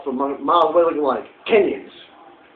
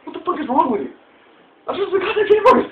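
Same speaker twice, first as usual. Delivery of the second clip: much higher, incredulous outburst; high exasperated mock rant; exasperated squeal; 12 semitones higher than usual. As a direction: squeal in disbelief at the clerk. Retold outburst at staff.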